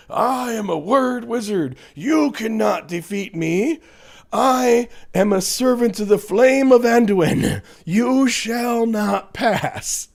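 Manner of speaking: high, slow, grand fantasy wizard voice; pompous wizard. Retold or imitated. Streamer mocking a troll as a Gandalf-style wizard. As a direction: proclaim it like a wizard in his basement. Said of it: "I get this picture ... Lord of the Rings".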